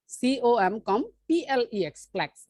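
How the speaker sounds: slow spelling of each syllable with a pause after "কম"; broken into syllables. Shown as a demonstration. Teacher showing how complex breaks in two, syllable by syllable. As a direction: spell "সিওএম" then say "কম", spell "পিএলইএক্স" then say "প্লেক্স". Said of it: "দুইবারে ভেঙে আমরা পড়তেছি".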